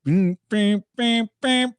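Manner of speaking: vocalized instrumental hook; imitated song riff. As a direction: mimic a beat with short vocal stabs. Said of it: "checked out Money Got Longer".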